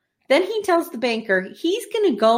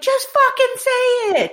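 impatient Laura; 13 semitones higher, 3 dB louder, slower than usual. Retold or imitated. Laura impatient, retold scene.

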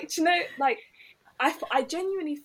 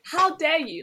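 retold outrage, higher and louder; mock telling-off, raised voice; indignant; 5 semitones higher, 5 dB louder, slower than usual. Woman confronting her privileged date, indignant.

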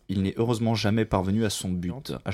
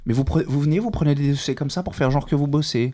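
louder, higher scolding rant; scolding boss; 5 semitones higher, 6 dB louder than usual. Acted. The doctor scolding the secretary for slacking, loud and higher. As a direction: tear into her for slacking.

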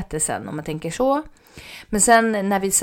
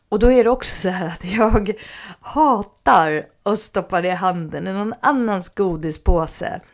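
normal talking voice

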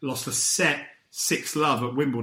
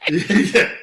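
laughing; 3 semitones higher, 8 dB louder than usual. Real, not acted. Laughing at the joke.

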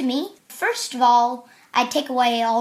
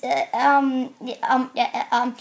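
stammering stream of ums and uhs; imitating a flustered, tongue-tied man; tripping over words. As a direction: stumble and stall on filler sounds. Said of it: "he just kind of trips over his words".

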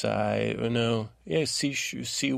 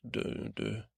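normal talking voice

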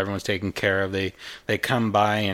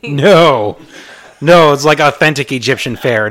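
loud, insistent denial; emphatic loud no; 5 semitones higher, 12 dB louder than usual. Real, not acted. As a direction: shout the no down, firmly. Insisting the food is authentic.

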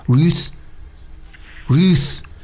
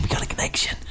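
whispered; 8 dB quieter, much faster than usual. Shown as a demonstration.

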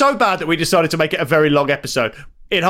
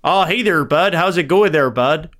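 put-on friendly Canadian accent. Shown as a demonstration. Host guesses where he's from, put-on Canadian accent. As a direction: greet a pal in a Canadian accent. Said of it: "do one and don't tell me where you're from".

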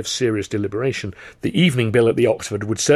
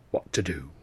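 soft, lower whisper of 'What to do?'; hushed musing whisper; 6 semitones lower, 8 dB quieter than usual. Acted. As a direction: breathe the repeat to yourself. Repeat dropped to a whisper.

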